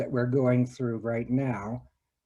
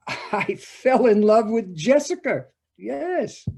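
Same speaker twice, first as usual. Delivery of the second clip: laughing; 7 semitones higher, 3 dB louder than usual. Real, not acted. Confessing love to Jim.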